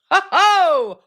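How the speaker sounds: high, loud "ho, ho"; a sudden hammed-up chuckle; little mock Santa laugh. Real, not acted. Reacting to chat, burst out.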